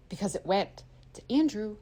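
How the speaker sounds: ordinary talk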